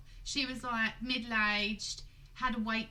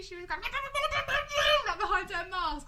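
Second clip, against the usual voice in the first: laughing; 12 semitones higher, 4 dB louder, much slower than usual. Real, not acted. Laughing recounting angry woman.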